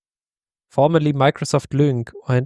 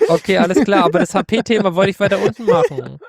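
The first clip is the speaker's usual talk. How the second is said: sheepish laughing reply; laughing while talking; 6 semitones higher than usual. Real, not acted. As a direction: laugh it off, embarrassed at the mix-up. Caught off guard, laughing it off.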